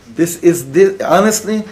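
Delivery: ordinary talk, nothing marked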